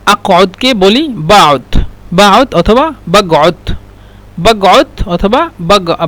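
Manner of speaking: বাউত and বাগউত spelled out very slowly, each sound drawn out; a teacher carefully sounding out বাউত against বাগউত; slow pronunciation demo. Shown as a demonstration. Teacher showing viewers the two dialect pronunciations, slowly. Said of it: "কফকে দুইভাবে উচ্চারণ করে".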